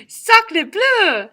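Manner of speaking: high, loud duck exclamation; exasperated French duck. Acted. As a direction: huff it out like an offended duck. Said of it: "said the duck".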